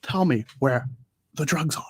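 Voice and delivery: lightly voiced actor impression; a hint of the famous actor, broken into short phrases; Pacino. Mimicking the parody's actor voice, lightly put on. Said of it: "I like it when he's doing Pacino".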